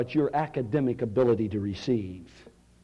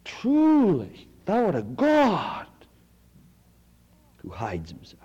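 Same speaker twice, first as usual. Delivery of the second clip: ringing proclamation; 7 semitones higher, 4 dB louder, slower than usual. Acted. Second reading of Isaiah's words.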